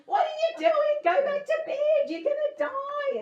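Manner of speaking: high, loud panicked inner alarm voice; screaming amygdala. Pretend. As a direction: shriek like a frantic inner alarm. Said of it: "my amygdala keeps screaming at me".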